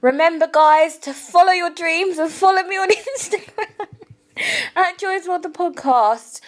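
host's big sign-off to her listeners; outro voice. Shown as a demonstration. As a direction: sell the catchphrase like a show host. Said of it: "this is my outro".

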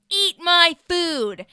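loud, high, drawn-out command; angry chef. Retold or imitated. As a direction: imitate an enraged chef yelling slowly. Acting out the angry school chef.

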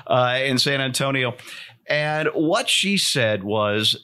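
ordinary delivery

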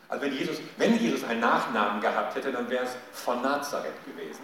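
ordinary talk, nothing marked

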